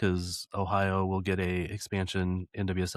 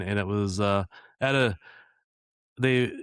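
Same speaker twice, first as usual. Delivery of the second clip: ordinary talk, nothing marked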